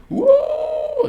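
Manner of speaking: very high, loud party whoop; imitated screaming whoop. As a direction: scream it out like a rowdy party kid. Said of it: "He's just screaming".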